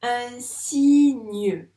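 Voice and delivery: "signe" loud, stretched as siii-gneu; syllable by syllable. Shown as a demonstration. Teacher placing the card, "signe" loudly split in syllables. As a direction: draw "signe" out in two syllables. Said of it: "elles forment le son gne".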